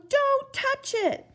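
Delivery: high, slow, drawn-out warning; urgent warning. A narrator's reading. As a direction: plead him not to touch it. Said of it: "shouted Bartholomew".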